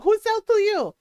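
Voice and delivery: high, loud mock interrogation; judgy tita. Aunt demanding who sold her drugs, judgy and loud. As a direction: imitate an accusing Filipino aunt. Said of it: "one of my more judgy titas".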